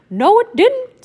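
high, loud mouse voice; squeaky mouse voice; Mickey voice. Acted. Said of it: "said Mickey".

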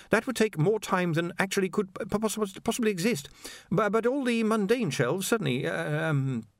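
high, stammering anxious scholar; a jittery young wizard stammering high; nervous wizard. A narrator's reading. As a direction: stumble over every word, flustered. Ponder voiced in audiobook.

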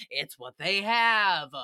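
slow, drawn-out punchline; emphatic drawl. Real, not acted. Host answers her own question about fish, slowly emphatic. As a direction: stretch each word to state the obvious.

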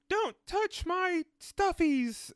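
high, slow, drawn-out little squeal. Retold or imitated. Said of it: "like, [name]'s like".

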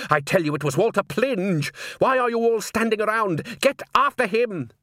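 high, insistent urging, rising as it goes; rally the crowd with mounting urgency; urgent command. A narrator's reading. Salzella sending the men after Walter, urgent.

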